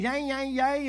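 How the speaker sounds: imitating a bickering family, high and fast; high, quick mock bickering; mock argumentative chatter. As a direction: rattle it off like everyone squabbling at once. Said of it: "that was as argumentative".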